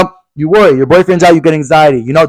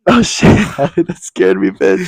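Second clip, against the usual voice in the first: real startled laughter, slowed and breaking up the words; laughing after a scare; 4 semitones higher, slower than usual. Real, not acted. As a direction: giggle through the words.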